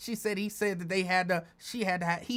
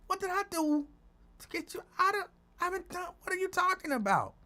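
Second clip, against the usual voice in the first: confused; 11 semitones higher, faster than usual. Retold or imitated. Speaker defending herself, confused.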